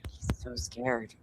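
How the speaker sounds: breathy hushed whisper. Real, not acted. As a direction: whisper as if someone could hear. Hushed aside while watching tense scene.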